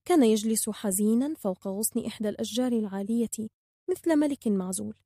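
plain reading voice

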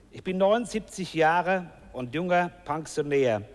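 ordinary delivery

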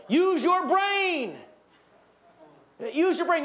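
high, loud and slow parental nag; scolding parent. Retold or imitated. Parent lecturing a teenager, loud and drawn out. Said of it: "taught to say to their teenagers".

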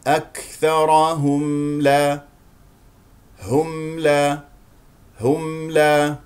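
slow أكثرهم لا with the M fully voiced; clear sounded M. Shown as a demonstration. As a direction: hum the م of هم before لا, uncut. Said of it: "الميم المظهرة دائمًا نسمع صوتها". (أكثرهم لا بإظهار صوت الميم)

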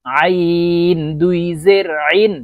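slow আইন squeezed from the middle of the throat; pressed mid-throat ain. Shown as a demonstration. Teacher modelling for learners, long pressed আইন from mid-throat. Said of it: "আইন চাপ দিয়ে নিচের দিকে".

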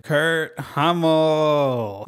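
very slow, drawn-out name reveal; theatrical, lingering announcement of the name; dramatic reveal. Acted. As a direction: stretch out KURT HUMMEL for a big reveal. You know who else was a closeted theater kid.

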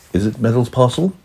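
ordinary delivery

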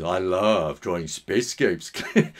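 slow troll voice, slightly low; cave troll voice. Acted. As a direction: lumber through it like a troll. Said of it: "that's my Sexy Cave Troll".

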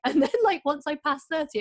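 normal talking voice

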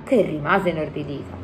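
ordinary delivery, nothing marked

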